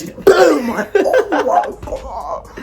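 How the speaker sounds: loud boom with a laugh; mock impact yell. Retelling punching the fence, loud boom and laugh. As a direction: shout the punch noise, laughing.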